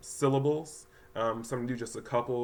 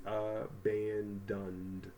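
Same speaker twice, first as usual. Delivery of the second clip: "abandoned" slowly as "uh... ban... dund"; syllable by syllable; 2 semitones lower, 6 dB quieter, much slower than usual. Shown as a demonstration. Teacher blending the word for students, syllable by syllable. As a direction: break "abandoned" into three slow, soft syllables. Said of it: "so this word is".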